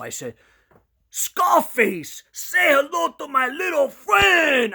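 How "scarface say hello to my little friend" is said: very high shouted movie quote; flipping out. Retold or imitated. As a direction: yell it wildly, drawing out the famous line. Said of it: "I was going crazy. I'm flipping out".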